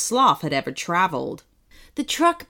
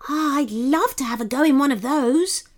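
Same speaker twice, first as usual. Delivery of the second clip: higher-pitched, gleeful longing; gleeful sloth; 5 semitones higher than usual. A narrator's reading. Sloth eyeing the truck he wants to drive, gleeful. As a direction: sound delighted and eager. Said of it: "said Sebastian with glee".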